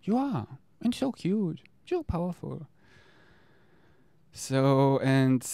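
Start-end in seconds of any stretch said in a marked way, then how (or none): 0.0-2.7 reassuring higher self. Pretend. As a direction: soothe a small scared part gently. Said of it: "And it's like".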